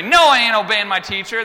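put-on defiant kid.

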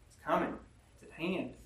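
ordinary delivery

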